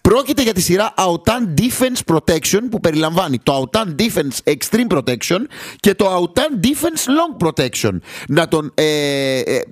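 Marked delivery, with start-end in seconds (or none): 0.0-7.9 lightly voiced product pitch; ad read. Host reading the sponsor ad, light ad-read tone.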